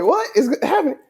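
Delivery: high, baffled what-is-happening; startled by a stranger's stare; confused surprise. Retold or imitated. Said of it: "look up and I'm like".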